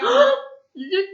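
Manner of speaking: sharp high cry of oops; sudden dismay. Acted. Narrator realizes he sang the last word, shocked. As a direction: blurt it out, caught.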